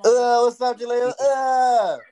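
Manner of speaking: high excited greeting. Real, not acted. Greeting the baby Jalil from across the room, high and loud. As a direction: call out brightly to little Jalil.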